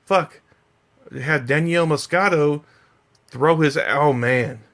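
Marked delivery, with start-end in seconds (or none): none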